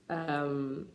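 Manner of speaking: usual speaking voice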